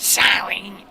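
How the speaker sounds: high, loud yelled sorry. Retold or imitated. Yelling after the woman across the store, loud. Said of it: "Real loud".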